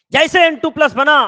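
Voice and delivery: high, loud story launch; dramatic storytelling. Acted. Teacher tells how N2 plus was born, dramatic and loud. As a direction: burst in loudly on the big moment.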